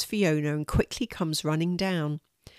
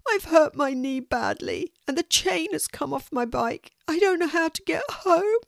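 put-on sobbing boy; 11 semitones higher than usual. A narrator's reading. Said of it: "he sobs".

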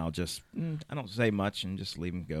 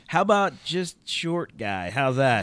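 sarcastic retort; 6 semitones higher, 8 dB louder, slower than usual. Retold or imitated. Retold retort to insult.

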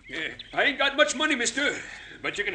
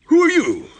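startled, higher and louder; alarmed demand; 6 semitones higher, 7 dB louder than usual. Acted. Wagon driver to armed stranger he can't place, alarmed.

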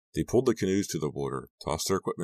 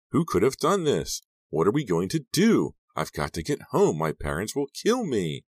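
high, louder panicked questions easing slightly; fearful cry; 7 semitones higher, 5 dB louder than usual. A narrator's reading. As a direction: wail in mounting worry, starting high. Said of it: "her eyes wide with fear".

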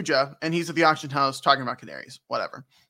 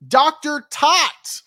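dramatic reveal; 9 semitones higher, 6 dB louder, slower than usual. Acted. Dramatic naming of character.

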